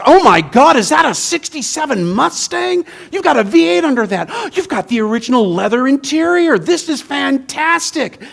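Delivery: a car nut spotting his dream car; gushing car fan. Retold or imitated. UU minister admiring the Mustang, thrilled and gushing. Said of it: "the U U minister goes".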